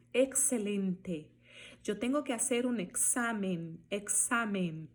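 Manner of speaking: EXCELENTE and EXAMEN with a crisp "ks". Shown as a demonstration. Teacher modelling Spanish X, "ks" in examen. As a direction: say examen as "eksamen", never Z. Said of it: "som de K S".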